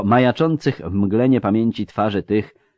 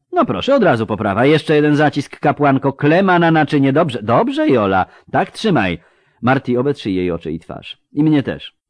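urgent, raised surgeon's voice; harried field surgeon; 8 semitones higher, 8 dB louder than usual. Acted. Surgeon pressing helpers over a thrashing patient, urgent. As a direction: give orders mid-operation, higher and louder.

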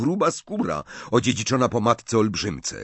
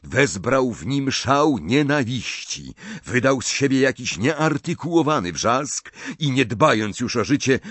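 ordinary delivery

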